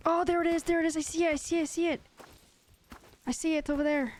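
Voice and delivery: high, quick and eager, pitch jumped way up; excited spotting. Real, not acted. Gamer spotting the bear he lost, excitedly calling it out. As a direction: blurt it out as you finally catch sight of it.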